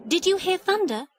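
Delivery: high, loud called question; calling out. A narrator's reading. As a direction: shout the question across the yard. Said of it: "she called".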